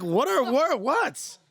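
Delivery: high, slow, baffled; mock confusion. Singer reenacting his confused look at Sean. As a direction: play the innocent, clueless. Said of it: "I'm looking at Sean like".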